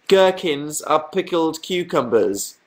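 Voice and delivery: slow, deliberate announcement; slow reveal. Real, not acted. Streamer revealing last week's discovery to viewers, slow and deliberate. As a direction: spell it out slowly, word by word.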